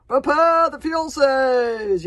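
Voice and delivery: slow drawn-out bellow, high and loud; yell. Acted. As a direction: roar the order to the whole tribe. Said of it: "yelled the chief Berserk".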